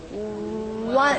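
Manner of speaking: usual speaking voice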